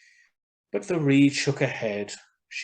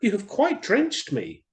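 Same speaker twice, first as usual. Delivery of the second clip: higher, louder bird voice for the swallow; swallow's voice; 10 semitones higher, 5 dB louder than usual. Acted. Swallow explaining to the reed, higher and louder. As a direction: voice the swallow up high.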